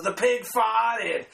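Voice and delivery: slow mock-innocent The pig farted; slow deadpan punchline. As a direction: draw out the pig farted slowly. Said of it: "And my brother goes".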